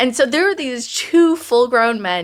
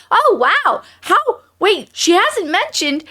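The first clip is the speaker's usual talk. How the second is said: high, wide-swinging astonished reaction; a startled higher-pitched double take; 9 semitones higher than usual. Retold or imitated. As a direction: act out being amazed and confused. Reenacting own astonished realisation.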